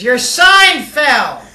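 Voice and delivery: put-on loud, slow drunken call. Drunk passerby calling out to the madam, loud and slow. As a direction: bellow it out slowly like a drunk.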